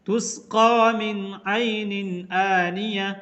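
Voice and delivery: slow, melodic tajweed chant; धीमी तिलावत की आवाज़; Quran recitation. Shown as a demonstration. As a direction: recite it slowly like tilawat. Recites Quran verse as example.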